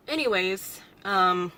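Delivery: normal talking voice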